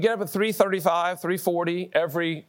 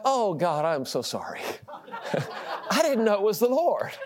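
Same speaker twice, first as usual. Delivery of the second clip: laughing apology. Retold or imitated. Embarrassed prayer with laughter.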